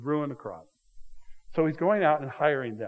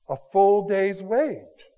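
"a full day's wage" slow, higher and louder; full day's wage stressed; 6 semitones higher, 8 dB louder, much slower than usual. Shown as a demonstration. Preacher stressing the payout to his congregation, slow and loud.

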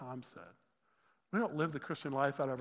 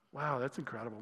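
usual speaking voice

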